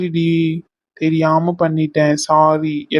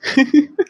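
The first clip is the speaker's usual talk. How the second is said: a short rising laugh he can't hold back; 9 semitones higher than usual. Real, not acted. Husband laughs as wife tries to scold him, giggle. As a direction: break into a laugh mid-scolding. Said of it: "இப்படி சிரிச்சுக்கிட்டு இருந்தா".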